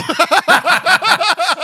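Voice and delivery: high, loud burst of laughter; big laugh. Real, not acted. Host cracking up at co-host's wrong guess, big high laugh. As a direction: crack up loudly, pitched high.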